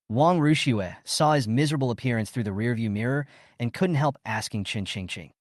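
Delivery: ordinary delivery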